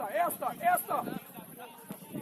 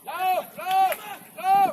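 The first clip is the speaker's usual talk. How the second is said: loud, punchy repeated calls; urging shout; 7 dB louder, slower than usual. Real, not acted. Urging a teammate to run, loud shout. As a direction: shout a runner on.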